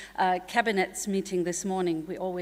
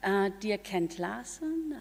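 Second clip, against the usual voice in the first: ordinary talk, nothing marked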